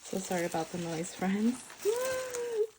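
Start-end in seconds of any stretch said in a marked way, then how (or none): 1.9-2.7 very high delighted squeals. Real, not acted. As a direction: squeal like a thrilled fan. Squealing over journal.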